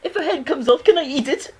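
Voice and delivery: high, loud cartoonish character voice; squeaky, hammed-up question; Peter. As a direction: pipe up loudly in a high-pitched put-on voice. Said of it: "Peter was like".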